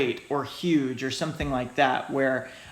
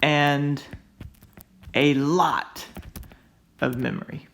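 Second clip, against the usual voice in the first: slow, spaced stress on "a lot"; "a lot" leaned on, "of memory" trailing off; over-emphasized words; slower than usual. Shown as a demonstration. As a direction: pause between "a lot" and "of memory" to press them. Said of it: "over-exaggerate".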